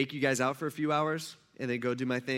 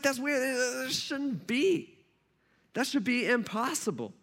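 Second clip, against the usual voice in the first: incredulous; 8 semitones higher, slower than usual. Retold or imitated. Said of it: "He's like".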